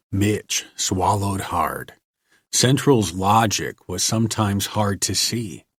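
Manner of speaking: plain reading voice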